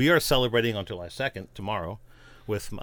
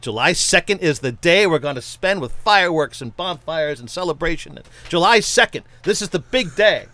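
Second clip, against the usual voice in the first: proclaiming; 5 semitones higher, 5 dB louder than usual. Acted. John Adams proclaiming.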